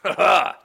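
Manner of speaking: short, high, loud "ha ha"; loud laugh. Real, not acted. Co-host reacts to the turn of events, loud laugh. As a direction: burst out with a sudden loud laugh.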